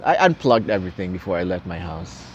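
ordinary talk, nothing marked

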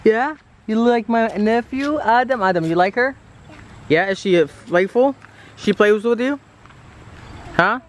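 high sing-song pet voice. Real, not acted. Owner calling puppy and asking nephew Adam, high doting coo.

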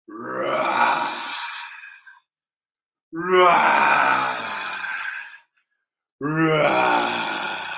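low growling tiger roar. Pretend. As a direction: roar like a playful tiger for the kids. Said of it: "I'm going to be a tiger".

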